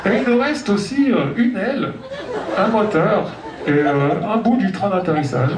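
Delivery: calm airline captain on the intercom; pilot announcement. Pretend. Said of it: "l'annonce du commandant de bord".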